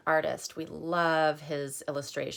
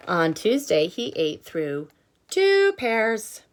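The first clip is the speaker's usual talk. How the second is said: louder, slowed, emphatic picture-book reading with the hungry refrain swooping up; reading to children; 4 semitones higher, 7 dB louder than usual. Acted. Teacher reading aloud to children, dramatic refrain. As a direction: make every day a build to "still hungry".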